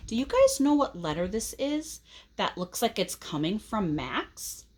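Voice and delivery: plain reading voice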